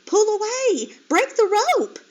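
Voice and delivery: high, loud desperate shout; desperate piglet shouting; urgent shout. A narrator's reading. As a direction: yell at her to break free. Flora urges mother to escape.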